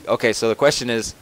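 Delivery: ordinary talk, nothing marked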